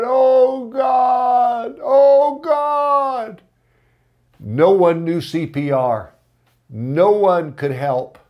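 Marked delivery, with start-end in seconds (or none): 0.0-3.4 retold desperate cry. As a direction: cry out to God in panic. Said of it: "all Sandy could do was cry out".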